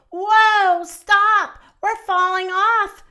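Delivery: mock-panicked squeal for children; squealed alarm. A narrator's reading. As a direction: call out like toppling animals, playfully. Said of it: "squealed Cat and Dog and Pig and Duck".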